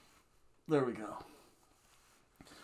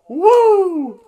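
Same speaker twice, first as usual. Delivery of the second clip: slow, very high, loud woo with a laugh; Ric Flair woo; 16 dB louder than usual. Retold or imitated. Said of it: "Pulled a Ric Flair".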